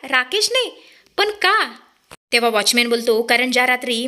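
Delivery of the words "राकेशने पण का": pitched well up in disbelief; a stunned, high cry of disbelief; Arjun's shocked outburst, much higher. A narrator's reading. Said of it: "Arjun shock होऊन बोलतो".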